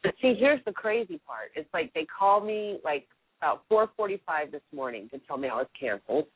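ordinary talk

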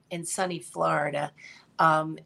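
normal talking voice

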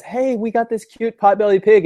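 high, bright neighborly call; cheerful neighbor. Retold or imitated. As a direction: chirp it like a proud pet owner.

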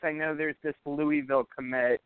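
ordinary delivery

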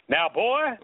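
loud Southern rooster drawl; Foghorn Leghorn. Retold or imitated. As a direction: bark it like the cartoon rooster. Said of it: "Foghorn Leghorn always did".

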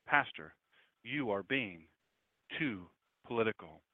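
low, soft reproach, broken by pauses; measured reproach. Retold or imitated. Critic admonishing the pastor for politics, measured reproach. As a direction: spell out the complaint in weighty pieces.

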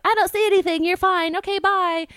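high, loud singsong brush-off; breezy dismissal. Retold or imitated. Sister leaves to meet friends, high breezy brush-off.

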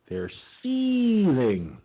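drawn-out, high mocking delivery; mock seething. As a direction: sneer it out slowly. Mocking angry Democrats.

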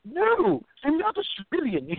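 a hammed-up high Aussie protest; [name]'s bad Aussie accent. [name] denying he is Australian, badly faked Australian accent. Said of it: "I'm horrible at those accents".

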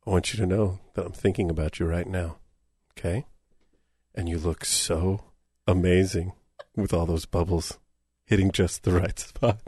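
a low, intimate croon for Lisa; sexy voice. Pretend. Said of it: "that's not the voice you use with your wife".

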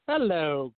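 a loud, put-on gruff growl, Scrooge faking his old self; faked old growl. A narrator's reading. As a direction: bark the greeting like the old miser, but it's a pretence. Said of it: "growled Scrooge in a custom voice as near as he could fake it".

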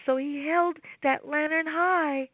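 plain reading voice

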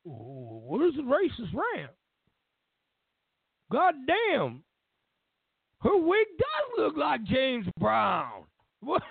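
slow, high, put-on old man's voice that jumps up on God damn. Old man watching TV, amused, slow high shout. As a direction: voice an amused elderly man, drawn out and rising to a shout. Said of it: "and he goes".

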